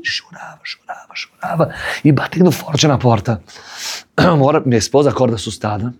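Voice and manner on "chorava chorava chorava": hushed whisper. A narrator's reading. Rabbi recalling son crying, hushed whisper. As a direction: whisper it to draw them in.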